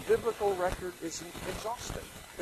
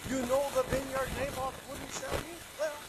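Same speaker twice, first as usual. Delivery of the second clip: sly wife; 9 semitones higher, 3 dB quieter than usual. Acted. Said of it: "She said to Ahab".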